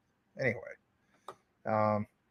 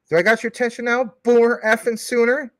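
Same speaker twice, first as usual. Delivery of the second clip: raised, forceful mock-monster demand; Crypto Hulk; 8 semitones higher, 5 dB louder than usual. Streamer demanding a viewer's attention, Hulk impersonation. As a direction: push it high and loud, as a Hulk act. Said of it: "that's my Crypto Hulk impersonation".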